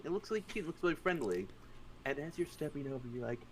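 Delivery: ordinary talk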